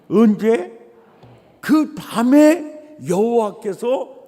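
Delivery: loud, high preaching cadence; loud, rising preaching; full-force preaching. Acted. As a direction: drive the point home to the church.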